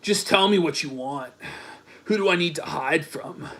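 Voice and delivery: low, soft lover voice; hushed mock-romantic reading; mock suitor. Voicing Dick's romantic text.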